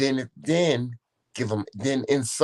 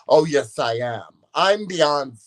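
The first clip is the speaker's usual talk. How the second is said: higher, louder sassy comeback; proud retort; 4 semitones higher, 5 dB louder, slower than usual. Retold or imitated. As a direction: insist on it like a diva, retold. Retelling her proud retort.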